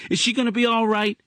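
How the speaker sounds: put-on high child's voice, cheerful; a boy calling to his aunt; young boy voice. Boy character voice.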